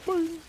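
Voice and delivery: high springy boing; springy boing sound. Pretend. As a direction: bounce the word up high.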